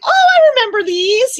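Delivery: like spotting an old favourite cartoon; excited recognition. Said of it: "I'm like".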